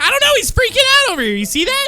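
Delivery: alarmed outburst. Acted. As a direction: blurt it out in sudden alarm. Friend suddenly freaking out.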